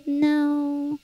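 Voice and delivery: soft, drawn-out mimicked no; small refusal in a little kid's voice; stubborn toddler. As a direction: quietly imitate a defiant child. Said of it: "she's like".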